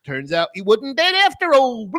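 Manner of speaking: high, loud gasped revelation; mock fantasy character. Characters revealing a survival, hammed-up mock voice. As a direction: announce it high in a silly British voice. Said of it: "they're like".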